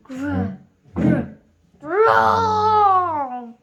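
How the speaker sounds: loud sudden roars, rising high. A narrator's reading. Scary forest noise read to a child, loud roars. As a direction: burst into a big mock roar. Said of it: "Just suddenly".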